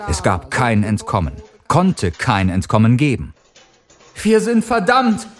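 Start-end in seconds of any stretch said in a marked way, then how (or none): 4.1-5.3 much higher, slow despairing call; Clara calling. A narrator's reading. Clara calls over the avalanche, high and despairing. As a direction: cry it out over the noise. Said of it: "rief Clara".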